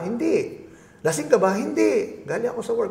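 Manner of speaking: high, insistent protest; defensive denial. Retold or imitated. Husband denying to his wife he was drinking, high protest.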